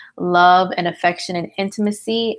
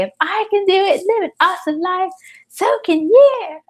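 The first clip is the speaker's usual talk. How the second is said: much higher, upbeat pep-talk voice; high-pitched mock inspirational speaker, stretching the last words; beaming motivational speaker; 11 semitones higher than usual. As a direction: say it through a big old smile, pitched way up. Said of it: "say all that stuff with a big old smile".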